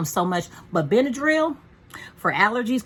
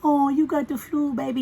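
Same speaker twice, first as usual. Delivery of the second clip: put-on high sing-song baby talk to a sick dog; cooing baby talk; 6 semitones higher than usual. Owner cooing to her sick dog, high baby talk. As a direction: coo at him like a baby.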